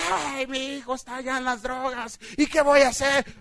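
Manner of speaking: hammed-up tearful plea; mock crying parent. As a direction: act out a despairing parent. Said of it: "estás llorando y llorando".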